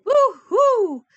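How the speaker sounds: high, loud hooting wail; mock owl cry. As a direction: hoot it out in exaggerated alarm. Owl cries out at the Gruffalo.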